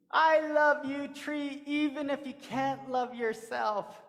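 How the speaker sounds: a touch of tender pleading; mean every word of it, gently; tender declaration. Acted. Coyote tells the tree he loves it, tenderly.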